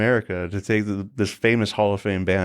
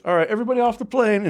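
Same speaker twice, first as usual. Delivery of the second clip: mock command; 12 semitones higher than usual. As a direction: order everyone off the plane. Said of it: "he goes".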